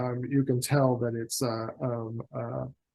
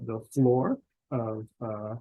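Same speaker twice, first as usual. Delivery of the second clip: ordinary talk, nothing marked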